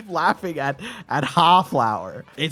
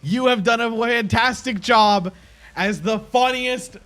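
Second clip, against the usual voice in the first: higher and louder, slow, grand proclamation; mock ceremony; 8 semitones higher, 4 dB louder than usual. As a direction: crown the winner like a mock herald. Crowning the winner ceremonially.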